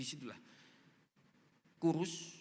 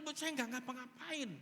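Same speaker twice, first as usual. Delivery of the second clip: high, soft startled disclaimer; startled protest; 7 semitones higher, 5 dB quieter than usual. Retold or imitated. Startled pastor tells the daughter he did nothing, retold startled protest. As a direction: protest your innocence, high-pitched and taken aback. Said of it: "saya kaget".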